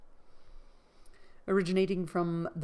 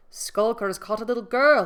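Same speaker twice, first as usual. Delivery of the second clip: loud, higher servant's report; excited report; 4 semitones higher, 9 dB louder than usual. A narrator's reading. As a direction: call it out to the master.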